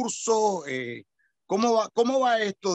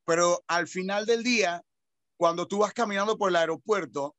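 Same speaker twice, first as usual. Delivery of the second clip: ordinary talk, nothing marked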